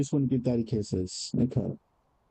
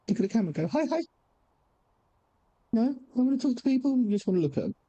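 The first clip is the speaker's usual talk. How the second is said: pet talk; 8 semitones higher, faster than usual. Real, not acted. Owner fussing over the cat's sore nose, in high baby talk. Urging cat to greet chat.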